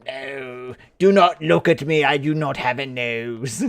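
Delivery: villain with a mock French accent; French Voldemort. Villain refusing to be looked at, French accent. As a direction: play a dark wizard as a Frenchman. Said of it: "A French Voldemort".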